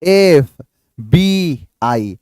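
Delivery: very slow एफ़बीआई, each letter clear; slow demo. Shown as a demonstration. Teacher demonstrating एफ़बीआई slowly to the class. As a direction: spell out एफ़बीआई slowly and deliberately. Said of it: "तो एफ".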